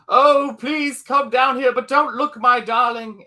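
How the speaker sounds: luring lover. Retold or imitated. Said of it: "He's like".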